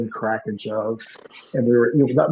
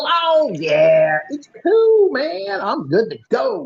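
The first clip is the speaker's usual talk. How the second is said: much higher, breezy and upbeat; carefree guy voice; 12 semitones higher, 3 dB louder, much slower than usual. Retold or imitated. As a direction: play him as totally unbothered, a light imitation.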